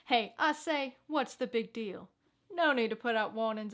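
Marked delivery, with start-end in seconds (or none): none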